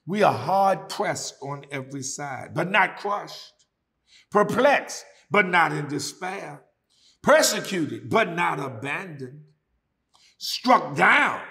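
loud, emphatic phrases; fervent scripture reading. Acted. Preacher reading Paul to the church, loud and emphatic. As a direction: hammer each word.

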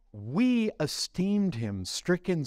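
slow reading, "We" stretched and swooping up; stress on We. Shown as a demonstration. Preacher rereading Isaiah for the congregation, heavy stress on "We". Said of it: "did you catch this?".

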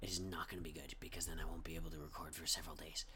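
low put-on voice, held on; a quiet, low put-on voice. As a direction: keep the low croaky voice going. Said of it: "I will keep speaking like this".

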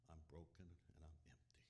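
soft, hushed admission; confessing his brokenness to the congregation in a whisper; whispered confession. Real, not acted. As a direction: let it fall to a whisper. Preacher confessing his own brokenness.

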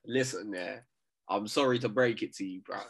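usual speaking voice

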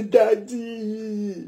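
put-on a child's whining plea for daddy; pleading child. Child pleads with father, whining. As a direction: whine it like a child wanting money.

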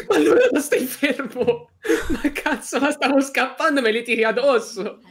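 high laughing protest; laughing complaint. Real, not acted. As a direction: laugh while you complain. Hit while fleeing, laughing.

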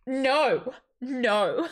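loud, laughing refusal; a laughing, fed-up no; exasperated no. Retold or imitated. Remembering the plane, she cries no with a laugh.

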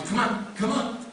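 high, coaxing dog-walker call; light, high beckoning to a pet. Acted. Calling his dog on a walk.

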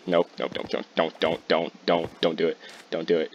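low, soft repeated caution; urgent self-warning. Real, not acted. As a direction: talk yourself out of it under your breath. Warns self off a chase.

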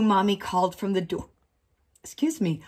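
plain reading voice

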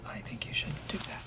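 soft unvoiced whisper. Real, not acted. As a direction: whisper it so only she hears. Whispered aside in office.

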